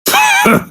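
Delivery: short high scornful laugh; mocking laugh. God mocking the fusion dance, high laugh.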